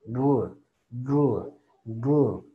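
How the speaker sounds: "Gl" isolated, G gliding straight into L; gl cluster. Shown as a demonstration. Teacher isolates the G-L cluster. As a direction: drill "gl" as one tight cluster. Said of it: "la G de gato con la L suena así".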